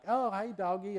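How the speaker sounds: lightly voiced young boy, friendly; little boy greeting. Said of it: "my brother was sort of like".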